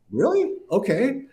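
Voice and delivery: sudden high astonished squeak; imitating his own amazement; surprised. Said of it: "I just went".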